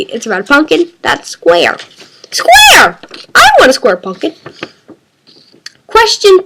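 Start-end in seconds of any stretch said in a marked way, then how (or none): 2.3-4.3 excited squeal. Pretend. Excited cry repeating the pumpkin's shape.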